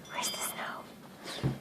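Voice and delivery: whispering "where's the snow"; whispered. Real, not acted. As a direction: whisper it playfully. Whispered aside to dog.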